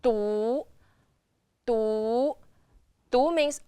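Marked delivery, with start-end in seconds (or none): 0.0-2.3 DU long, gliding upward; second tone. Shown as a demonstration. Teacher demonstrating the second tone going up, rising du. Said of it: "the second tone going up".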